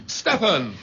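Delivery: sharp high call of his name. Acted. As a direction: cry out to stop him. Calling after the fleeing father.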